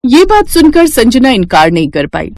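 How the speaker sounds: ordinary delivery, nothing marked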